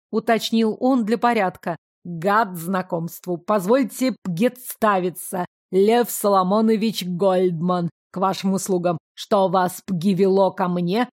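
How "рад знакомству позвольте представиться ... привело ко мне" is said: lawyer with a guttural burred r, every r in «Ягослава Елизаговна Ольбганская» rolled in the throat. A narrator's reading. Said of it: "смешным картавым говором".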